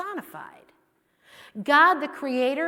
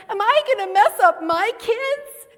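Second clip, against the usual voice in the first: worried question; 10 semitones higher, 8 dB louder than usual. Retold or imitated. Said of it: "I said".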